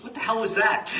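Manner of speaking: high, loud incredulous outburst; shocked outburst. Retold or imitated. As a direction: blurt it in disbelief. Archbishop reacts to botched chant.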